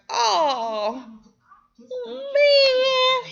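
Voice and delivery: shooting way up high on "man"; excited outburst. Real, not acted. Viewer reacting to the drama twist, excited squeal. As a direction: let it fly up in disbelief.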